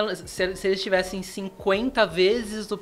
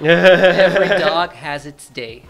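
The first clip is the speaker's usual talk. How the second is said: laughing; 13 dB louder, much slower than usual. Real, not acted. Slow laughing answer.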